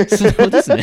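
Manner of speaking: laughing, high and loud. Real, not acted. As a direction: laugh through the words. Laughing at frank answer.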